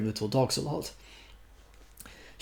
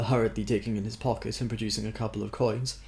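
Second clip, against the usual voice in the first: ordinary delivery, nothing marked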